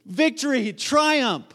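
high, loud jubilant cries; triumphant cheering, punched out word by word; excited crowd. Acted. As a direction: exclaim it like an overjoyed worshipper. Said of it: "They're so excited".

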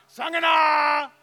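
long, high, drawn-out yell of a name; screaming for daughter. Retold or imitated. Father-in-law calling his daughter down from upstairs, full scream. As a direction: call her downstairs at the top of your lungs. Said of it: "Screaming at the top of his lungs".

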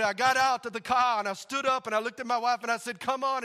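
put-on high, loud Ugandan-accented storytelling. Husband retelling how he urged his wife out, Ugandan accent. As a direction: imitate a worked-up Ugandan man's accent. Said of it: "that's how they talk".

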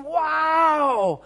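a huge, high shouted wow; amazed wow. Acted. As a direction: cry out in astonishment. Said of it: "people were thinking".

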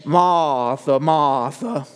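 mock Southern Louisiana accent; Doctor Stevens. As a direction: imitate a Southern Louisiana pastor gently scolding. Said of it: "make him a Southern Louisianan Cajun".

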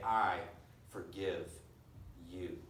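softening word by word into near silence; hushed. Acted. Preacher imagining a forgiven wrong, a fading hush. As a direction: let each word fall away gently.

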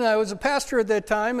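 normal talking voice

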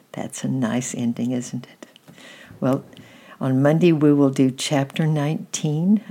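ordinary delivery

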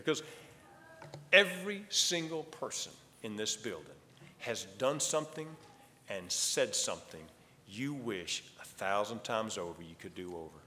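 ordinary talk, nothing marked

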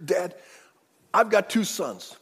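loud, earnest swearing to his father; 1 pleading oath. Retold or imitated. Reuben vowing to Jacob on his sons, loud pleading. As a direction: vow it with everything. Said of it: "he jumps up and he says".

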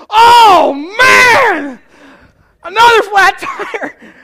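high, very loud hammed-up cry with a laugh; mock frustrated yell. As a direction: yell at yet another flat. His own tire frustration.

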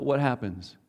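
ordinary talk

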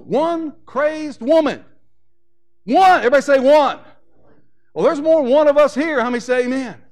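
slow, then loud and high on One; fervent preaching. Acted. Pastor calling on the congregation to say one, loud and fervent. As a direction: drive the word One home.

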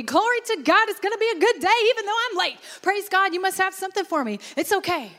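very high, fast, chirpy praise; cheerful praise anyway. Acted. Preacher voicing a late, sore believer praising cheerfully anyway. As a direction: stay joyful though everything goes wrong. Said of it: "your response is gonna be".

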